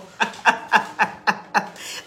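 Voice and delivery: long breathy cackling laugh; real laughing fit. Real, not acted. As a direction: burst into helpless, wheezing laughter. Laughs at being told she shouts.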